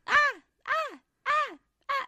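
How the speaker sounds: short high-pitched mock yells; sudden little shrieks, mimicking a child gaming; imitated yelling. Mum imitating her son yelling at his game, mock yells. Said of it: "yell, like".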